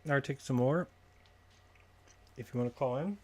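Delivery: talking while chewing; mouth full. Real, not acted. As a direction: speak through a mouthful of food. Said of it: "Sorry, I'm eating something".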